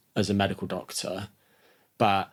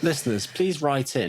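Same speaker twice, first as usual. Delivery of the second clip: put-on northern accent; 4 semitones higher than usual. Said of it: "Was that your northern accent?".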